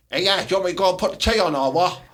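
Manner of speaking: fast, broad Teesside bloke voice, lightly done; a robot woman talking like a Teesside man, quick and blunt. Pretend. Robot woman in a Teesside man's voice, lightly put on. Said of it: "had the Teesside man voice".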